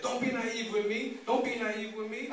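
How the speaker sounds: high-pitched mimicked scolding; flipping out. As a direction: imitate an official losing her cool. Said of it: "the lady flipped out on me".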